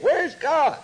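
high, mocking taunt that climbs as it goes; a scoffer mocking the troubled leader. Scoffing man taunts David.

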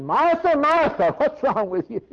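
high loud calling of her name; Jesus rebuking Martha, high and loud. Acted. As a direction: call her out like a parent.

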